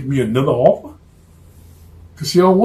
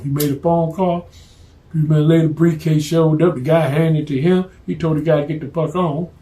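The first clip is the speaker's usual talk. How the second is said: ordinary talk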